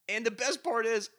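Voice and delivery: ordinary talk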